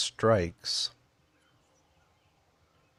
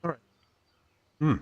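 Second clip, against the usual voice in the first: chewing while talking; mouth full; 5 semitones higher, much faster than usual. Real, not acted. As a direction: talk through a mouthful of food. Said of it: "Sorry, I'm chewing".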